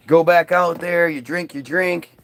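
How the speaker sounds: usual speaking voice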